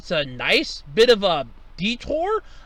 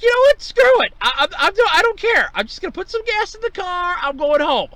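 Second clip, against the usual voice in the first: much higher, louder exasperated giving up; fed-up outburst; 11 semitones higher, 7 dB louder than usual. Retold or imitated. Driver confused by two gas prices, high loud fed-up outburst. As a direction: throw up your hands and quit caring. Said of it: "And I'm like".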